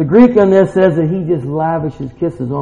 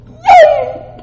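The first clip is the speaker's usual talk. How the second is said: very high, loud squeal; acted jubilant whoop; joyful cheer; 5 dB louder than usual. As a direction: burst out in delight. Father rejoices at son's return.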